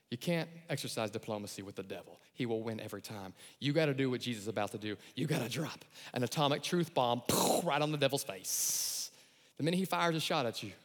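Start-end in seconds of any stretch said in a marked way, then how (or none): none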